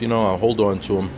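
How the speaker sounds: ordinary talk, nothing marked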